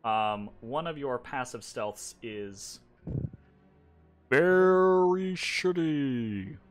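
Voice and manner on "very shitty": very slow, louder, stretched-out words; drawn-out verdict. Real, not acted. Game master revealing a bad stealth score, drawn-out verdict. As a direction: stretch it out for comic weight.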